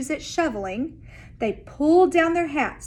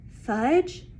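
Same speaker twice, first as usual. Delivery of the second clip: plain reading voice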